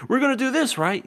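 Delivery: high-pitched, needy imitation; mock nagging check. Imitating people who remind you twenty times, mock nagging check. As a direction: ask it high, pestering for reassurance.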